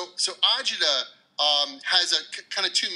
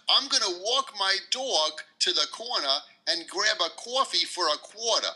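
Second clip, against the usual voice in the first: New York accent; 5 semitones higher than usual. Shown as a demonstration. Guest demonstrates New York sentence for listeners, thick accent. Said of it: "a usual sentence that we would say here in New York".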